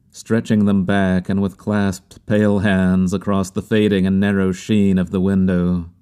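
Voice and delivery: plain reading voice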